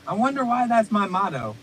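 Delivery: normal talking voice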